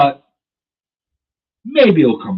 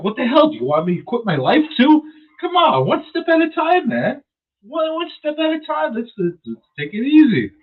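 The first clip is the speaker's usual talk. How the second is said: exasperated; 12 semitones higher than usual. Real, not acted. Exasperated rant at advice.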